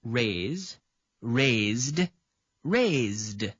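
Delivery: each form set apart and sounded clearly; slow conjugation drill. Shown as a demonstration. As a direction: read the forms out one by one. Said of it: "it is conjugated as follows".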